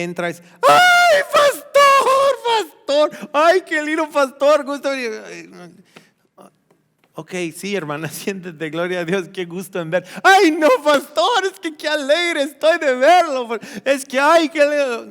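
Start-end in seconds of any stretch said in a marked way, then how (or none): 0.6-5.2 high, loud squealing church lady; shrill-voiced excited sister greeting the pastor; gushing church lady. Imitating an overexcited church lady. 10.2-15.1 high, loud squealing church lady; shrill-voiced excited sister greeting the pastor; gushing church lady. Same church lady again.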